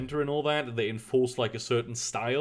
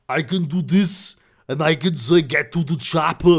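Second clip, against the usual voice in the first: put-on a passable Austrian Arnold accent, louder; Arnie accent; 8 dB louder than usual. Streamer asked by chat doing an Arnold Austrian accent. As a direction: growl out the action-hero line in Austrian English. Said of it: "an Arnie accent".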